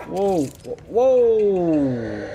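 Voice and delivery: loud, high startled whoa. Real, not acted. Gamer startled by a monster, loud whoa. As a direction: jump back in surprise.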